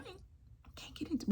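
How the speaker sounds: high whispered aside; breathy admission. Real, not acted. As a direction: confess it softly.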